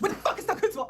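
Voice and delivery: loud aggressive yell; aggressive shout. Retold or imitated. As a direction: burst in shouting the question. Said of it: "barges the door and says".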